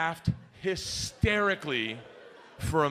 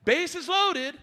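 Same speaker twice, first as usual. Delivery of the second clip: high, loud coach calling the play; 12 semitones higher, 4 dB louder, slower than usual. Retold or imitated. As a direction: announce the pretend fly ball like a coach. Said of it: "Coach is like".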